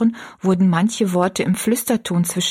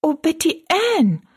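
high-pitched exclamation of dismay; aghast, much higher voice; dismayed cry; 10 semitones higher than usual. A narrator's reading. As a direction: cry out the name in shock, pitch soaring then falling. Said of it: "rief Rosalie bestürzt".

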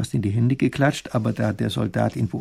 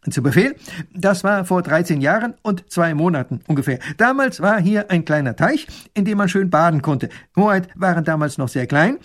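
put-on dutiful young soldier, higher and louder; 6 semitones higher, 5 dB louder than usual. Soldier reports proudly to the princess, eager and dutiful. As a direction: report eagerly like a loyal guard.

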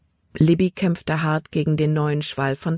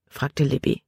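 ordinary delivery

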